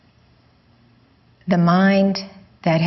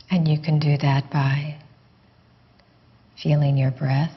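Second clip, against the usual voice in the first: guided meditation. Real, not acted. Teacher guiding listeners to let the question go, guided meditation. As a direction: ease them gently inward, leaving space after each phrase.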